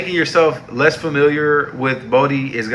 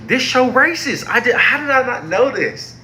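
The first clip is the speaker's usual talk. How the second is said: shocked realization; 12 semitones higher than usual. Real, not acted. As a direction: pitch jumps way up in disbelief. Realizing the show is racist.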